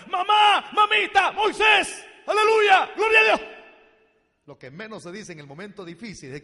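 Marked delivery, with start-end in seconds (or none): 0.1-3.4 very high, very loud screams; terrified screaming. Retold or imitated. Israelites trapped at the sea, screaming in terror. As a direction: shriek for help at the top of the voice. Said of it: "comenzaban a gritar".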